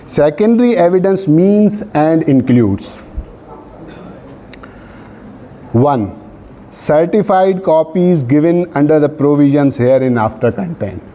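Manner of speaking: reads the law out word by word, very slow; measured legal dictation; slow dictation reading. Acted. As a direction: dictate so students can write it down. Dictating statute text to class.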